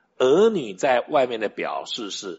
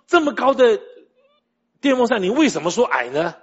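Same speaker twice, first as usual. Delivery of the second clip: high, loud imitation of a child's protest; 8 semitones higher, 5 dB louder than usual. Son disputing dad's claim about the fan, imitated protest. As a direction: argue back as an indignant little kid. Child arguing back, retold.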